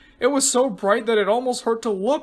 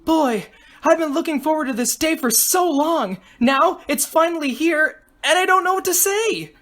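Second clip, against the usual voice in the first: put-on much higher, gushing young woman's voice; excitable girl contestant, thrilled; 7 semitones higher, 3 dB louder than usual. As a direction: bubble over with eagerness, pitch climbing.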